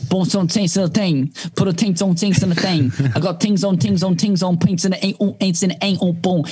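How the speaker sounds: mumbled mock London rap flow; British rapper impression. Host doing his British rapper impression, lightly mocking London accent. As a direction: rattle it off like a parody London rapper. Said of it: "every British rapper sounds like this".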